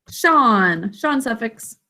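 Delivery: Sean suffix drawn out very slowly; slow emphasis. Shown as a demonstration. Teacher stressing the suffix, very slow. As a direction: linger on shun so students hear it. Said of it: "it's just the Sean suffix".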